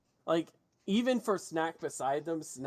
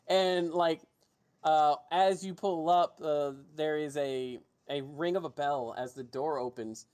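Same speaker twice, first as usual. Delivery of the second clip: normal talking voice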